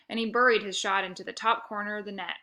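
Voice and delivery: ordinary delivery, nothing marked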